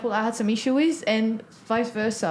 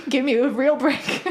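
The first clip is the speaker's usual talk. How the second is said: mock plea. Jokingly pleading as French speaker.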